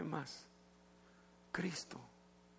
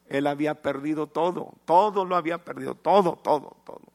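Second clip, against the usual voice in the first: louder and faster on every todo; building preached emphasis; 2 semitones higher, 7 dB louder than usual. Real, not acted. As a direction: hammer home that he lost everything. Preacher stresses total loss. (énfasis creciente, cada vez más fuerte en todo)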